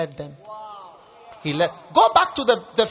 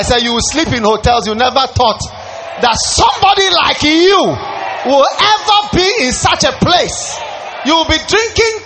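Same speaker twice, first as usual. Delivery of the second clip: higher, louder emphatic proclamation; fiery preaching; 7 semitones higher, 6 dB louder than usual. Acted. Pastor prophesying prosperity to the congregation, preaching at full force.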